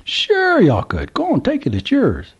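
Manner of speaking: put-on high, excited drawl of an old country man; old Southern gentleman. A narrator's reading. Old man calls to trucker, high Southern voice. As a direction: play the curious old man at the gas pump.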